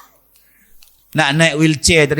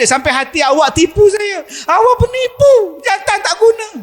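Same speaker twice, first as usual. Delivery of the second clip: rising to a high, shrill-pitched rebuke; angry wife; 12 semitones higher than usual. Acted. Wife scolding her lying husband, rising high. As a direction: scold him, climbing higher. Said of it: "Saya marah dia".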